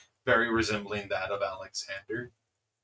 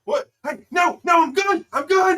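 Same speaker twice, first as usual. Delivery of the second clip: high, loud frantic refusal; panicked protest; 12 dB louder than usual. Acted. Player refusing the hunters, frantic shout. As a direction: back away shouting in panic.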